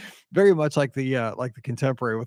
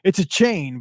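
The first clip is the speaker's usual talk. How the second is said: usual speaking voice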